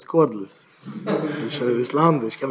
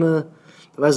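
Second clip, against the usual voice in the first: normal talking voice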